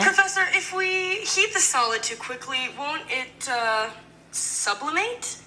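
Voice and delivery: high voice, slowing into a rising question; high-pitched student question. Shown as a demonstration. Sample line, plain English before French version.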